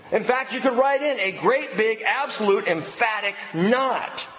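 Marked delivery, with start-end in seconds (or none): none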